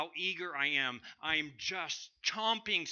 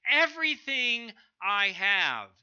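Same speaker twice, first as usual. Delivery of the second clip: loud, slow, high and fierce; booming resolute vow; resolved declaration; 8 semitones higher, 9 dB louder, slower than usual. Shown as a demonstration. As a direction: declare it with every fiber. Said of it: "we might say it this way".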